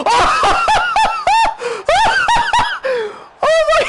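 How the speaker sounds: shrieking with laughter, very high and loud; ecstatic screaming. Real, not acted. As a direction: scream with joy at a wonder goal. Stunning goal scored in stream.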